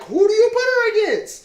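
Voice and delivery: loud, high-pitched incredulous question; exasperated outburst. Real, not acted. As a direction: throw it out loudly, voice shooting way up. Exasperated rhetorical question in debate.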